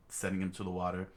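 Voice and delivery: plain reading voice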